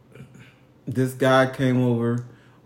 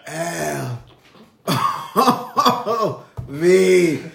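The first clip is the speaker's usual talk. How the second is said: spelling it out through laughter; laughing; 3 semitones higher, much slower than usual. Real, not acted. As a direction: giggle between letters.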